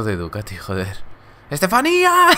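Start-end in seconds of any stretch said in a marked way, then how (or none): 1.5-2.3 very high, loud cry of a name; hammed-up dramatic yell; mock anguished shout. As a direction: holler the name like a TV catchphrase. Shouts a reality show catchphrase.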